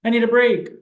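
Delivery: put-on high, little child's request; child's taught script. SLP voicing a child's taught script, pitched high. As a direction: pipe it up like a small child.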